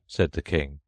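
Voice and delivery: ordinary delivery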